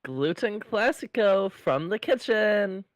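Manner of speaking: slow, loud waitress proclamation. Acted. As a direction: serve it with grand ceremony. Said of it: "you do the waitress".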